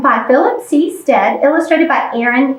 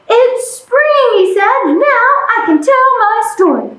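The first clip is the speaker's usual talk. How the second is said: excited; 8 semitones higher than usual. Acted. Bear wakes happy in spring.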